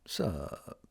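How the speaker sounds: a coworker at your workstation mumbling Sup; mumbled coworker sup. Retold or imitated. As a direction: mumble it like a bored colleague. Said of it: "and they say".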